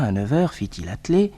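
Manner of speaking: usual speaking voice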